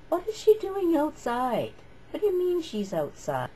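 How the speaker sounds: high, incredulous question; mimicking a startled teacher; surprised teacher. Teacher hears the girl is outside, mock astonished. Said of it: "And he said".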